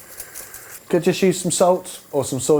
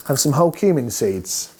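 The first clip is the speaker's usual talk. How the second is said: ordinary talk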